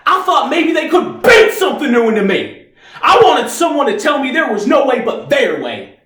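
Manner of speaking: high-pitched, anguished shouting; impassioned outburst. Acted. Reeves tells how the Army failed him, high, angry outburst. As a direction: build to a pained, shouted accusation.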